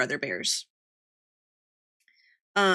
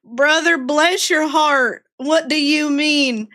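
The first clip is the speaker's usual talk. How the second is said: slow, higher, louder Georgia drawl, laughing at the end; drawled Southern exasperation; 6 semitones higher, 5 dB louder, slower than usual. Retold or imitated. Sister teasing her illiterate brother in a slow drawl. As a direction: stretch it out like a disbelieving Southern sister. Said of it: "I was like".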